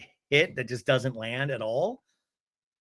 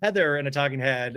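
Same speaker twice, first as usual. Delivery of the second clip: normal talking voice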